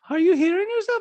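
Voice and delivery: loud, high put-on question; Diana. Diana repeating her challenge, high loud impression. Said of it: "No, she's saying".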